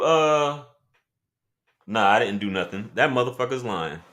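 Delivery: normal talking voice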